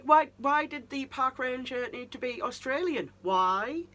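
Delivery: half-joking outrage at the casting; exasperated why. Retold or imitated. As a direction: demand an answer in mock despair. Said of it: "So I'm just like".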